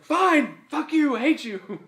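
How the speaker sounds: high, loud hammed-up tantrum; mock exasperated shout. Owner retells giving up on coaxing his dog, mock furious. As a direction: give up and yell it in mock hurt.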